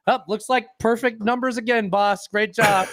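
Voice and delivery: high-pitched eager yes-man voice; assistant flattering boss. Acted. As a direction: chirp it like a fawning underling. Voices the assistant praising his boss.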